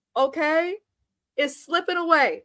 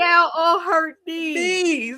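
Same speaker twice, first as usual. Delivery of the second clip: slow, higher laughing words; 4 semitones higher, 4 dB louder, slower than usual. Real, not acted. Host retells the kneeling while laughing. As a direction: talk through a laugh.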